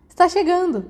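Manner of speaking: high, loud eager exclaim; can't hold it in; excited. A narrator's reading. Peter gushing to Remus, excited.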